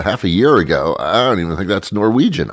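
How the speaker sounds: normal talking voice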